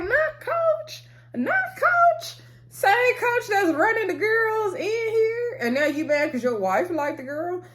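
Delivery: very high-pitched, incredulous ribbing; mock disbelief. Vlogger mocking the jealous coach, high-pitched incredulity. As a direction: squeal it in disbelief, calling out hypocrisy.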